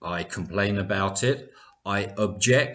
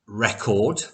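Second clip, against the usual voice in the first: "record" with the stress on REC; noun stress on first syllable. Shown as a demonstration. Teacher shows students an example heteronym, "record" stressed on first syllable. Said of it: "can you hear the difference".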